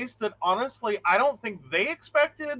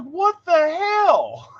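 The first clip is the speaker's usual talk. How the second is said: high, very slow, louder exclamation; baffled disbelief; 9 semitones higher, 5 dB louder, much slower than usual. Retold or imitated. Sister reacting to a deadpan brother, exaggerated disbelief. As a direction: stretch it out in mock bewilderment.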